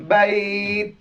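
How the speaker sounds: বাইত with the vowel glide held long; one-alif stretch. Shown as a demonstration. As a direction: stretch বাইত before the final t. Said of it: "এক আলিফ টান হবে".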